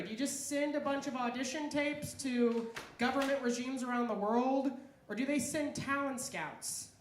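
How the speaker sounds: normal talking voice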